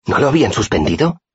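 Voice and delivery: high, disbelieving question; startled exclamation. A narrator's reading. Sim thought the punishment was suspended, surprised exclamation. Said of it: "exclamó Sim".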